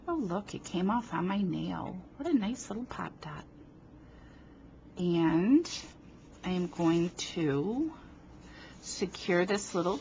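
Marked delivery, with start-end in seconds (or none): none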